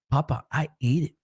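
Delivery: lightly voiced small boy; a hint of a toddler confessing to Papa; little son. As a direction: imitate a two-year-old owning up. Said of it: "little baby son".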